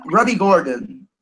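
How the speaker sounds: ordinary talk, nothing marked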